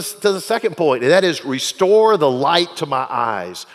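loud, rising sermon voice; fiery pulpit preaching; preaching at full force. Acted. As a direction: preach it, building the fire.

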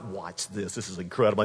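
low, knowing aside; cocky. Acted. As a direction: drop low like you've seen it before. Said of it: "There wasn't any cockiness".

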